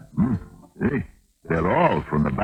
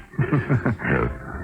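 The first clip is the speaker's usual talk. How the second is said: pleased chuckle; 6 semitones higher than usual. Acted. Stranger pleased about vodka.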